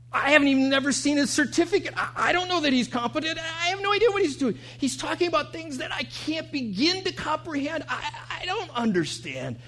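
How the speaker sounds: high-pitched, rattled protest; exasperated bewilderment. Retold or imitated. Patient who knows nothing about his doctor, high exasperated bewilderment. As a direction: pitch it way up as if overwhelmed and at a loss.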